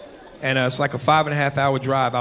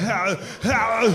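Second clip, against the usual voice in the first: Predator yelp; 5 semitones higher than usual. Acted. Performing the noise again.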